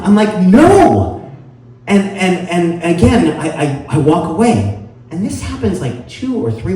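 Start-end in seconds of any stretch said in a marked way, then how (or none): 0.4-1.3 loud, high, emphatic mock yell; exasperated denial. Mistaken man answers the stranger once more, exasperated yell. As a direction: belt out the denial.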